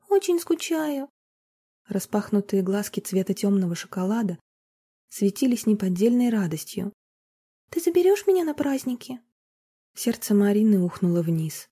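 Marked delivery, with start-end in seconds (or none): 0.1-1.1 put-on high, slow little girl's voice; girl tells her mother she misses her. 7.7-9.2 put-on girl tells her mother she misses her; little girl.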